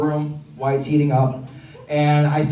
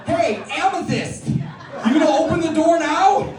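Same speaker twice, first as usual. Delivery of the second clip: high, slow shout through laughter; laughing shout; 11 semitones higher, slower than usual. Retold or imitated. Comic laughing as he calls out to the instructor to open the door. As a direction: yell it at her, cracking up.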